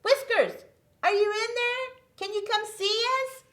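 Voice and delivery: high loud call; calling Whiskers out of his hiding place. Acted. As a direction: call him from far away. Calling out for the puppet.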